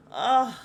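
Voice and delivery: loud, high frustrated groan; exasperated groan. Real, not acted. Mom fed up with her young adult, loud exasperated groan. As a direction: groan it out hard.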